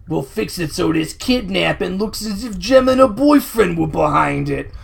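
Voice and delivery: slow, put-on henchman voice; Zipper. Henchman plotting to his partner about the kidnapping, put-on slow voice. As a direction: play a thug scheming, drawn out. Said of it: "I was just doing the Zipper voice".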